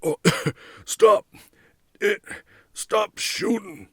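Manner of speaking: high, choked shout through coughs; strangled yell while gasping in gas; coughing and sputtering. A narrator's reading. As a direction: choke out the order between coughs. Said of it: "They coughed and sputtered".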